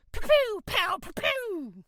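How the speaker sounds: high mock gunfire sounds; gun noises. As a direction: play-act a shootout with your mouth. Boy mimics gunfire defending Lynn.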